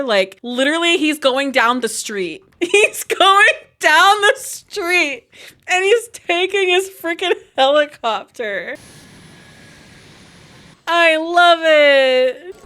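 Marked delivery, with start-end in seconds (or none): 2.6-8.7 high, stretched, laughing delivery; laughing incredulity. Real, not acted. Gamer watching him fly down the street, laughing in disbelief. Repeats it, cracking up. 10.9-12.6 delighted. Real, not acted. Delighted at helicopter trip.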